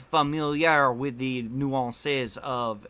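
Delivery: slow, mildly put-on French accent; mock French accent. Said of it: "I said nuance in a French accent".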